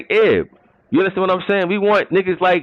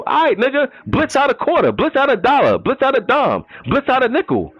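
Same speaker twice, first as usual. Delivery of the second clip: pitched up, sarcastic jabs; higher mocking retort; 7 semitones higher than usual. Real, not acted. Mocking a league player who only runs one blitz, higher sarcastic retort. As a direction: fire back, voice up high.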